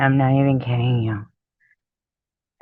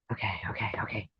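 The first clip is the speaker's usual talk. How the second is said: ordinary talk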